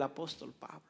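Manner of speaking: whispered sermon line. Acted. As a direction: lean in and whisper it.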